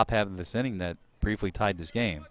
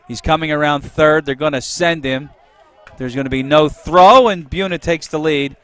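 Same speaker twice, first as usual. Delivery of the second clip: rising, much louder, higher home run call; excited play-by-play; 6 semitones higher, 14 dB louder than usual. Real, not acted. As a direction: build the call as the runner scores. Big hit play-by-play call.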